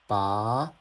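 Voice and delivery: "ba" sliding upward to the end; second tone. Shown as a demonstration. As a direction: lift the pitch steadily through "ba". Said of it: "Here's second tone".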